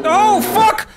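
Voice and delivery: high, loud burst of alarm; startled yelp. Real, not acted. Player surprised by an animal in the game, startled yelp. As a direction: yelp as danger jumps out.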